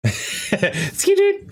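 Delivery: very high silly squeak; squeaky skidoo. Pretend. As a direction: squeak it out like a cartoon. Joining the scanner noises.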